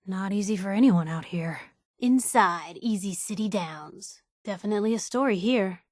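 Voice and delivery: ordinary talk, nothing marked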